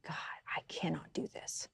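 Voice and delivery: barely voiced, hushed plea; hushed, defeated whisper; retold whispered prayer. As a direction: whisper it like a broken prayer alone in the car. Retold tearful prayer in car.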